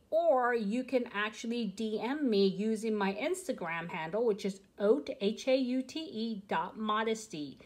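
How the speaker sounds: ordinary talk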